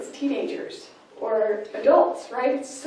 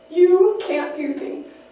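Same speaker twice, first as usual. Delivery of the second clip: higher, louder and slow, spelling out "You can't do things"; belittling mother; 6 semitones higher, 7 dB louder, slower than usual. Acted. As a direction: hammer home "You can't do things" as a put-down. Mother belittling teenage son.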